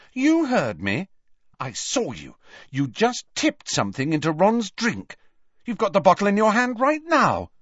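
high, insistent accusation; accusing. A narrator's reading. Hermione accusing Harry.

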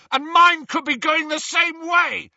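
loud, high, furious yell. Acted. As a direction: yell it back in fury. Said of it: "yelled Ron".

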